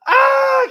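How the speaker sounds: high, loud mock shriek of surprise; startled scream. The other girls shrieking as she passes them, mock scream. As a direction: shriek like a runner being overtaken. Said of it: "All the other girls were like".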